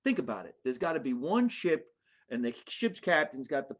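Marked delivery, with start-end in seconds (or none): none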